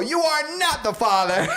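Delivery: high, laughing talk-show reveal; Maury announcement. Retold or imitated. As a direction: announce the result like a TV host. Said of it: "it plays the Maury sample".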